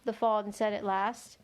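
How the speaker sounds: ordinary delivery, nothing marked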